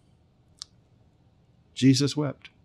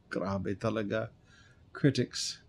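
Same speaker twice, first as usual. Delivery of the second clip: scoff; 5 semitones lower, 9 dB quieter than usual. Real, not acted. Scoffing at critics' remark.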